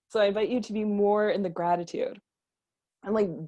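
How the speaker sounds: normal talking voice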